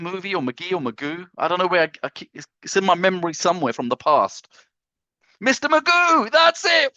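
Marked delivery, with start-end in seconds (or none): 5.4-7.0 high, loud eureka cry; excited recall. Real, not acted. Remembering the cartoon, loud and high excited. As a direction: blurt the name out as it comes back.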